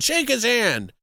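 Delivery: mock urging, pushed up high; escalated insistence. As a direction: press the order with comic urgency. Order repeated more insistently.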